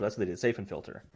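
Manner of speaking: usual speaking voice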